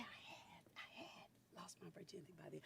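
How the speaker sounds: breathy confession in a whisper; whispered aside. Real, not acted. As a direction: let the secret slip in a whisper. Secret aside.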